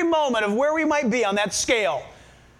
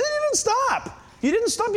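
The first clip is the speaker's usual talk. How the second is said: high-pitched insistent contradiction; mock-exasperated parent, voice jumping high; arguing parent; 11 semitones higher than usual. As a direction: insist on it, pitched right up, as in a retold squabble. Acted argument with daughter over stop.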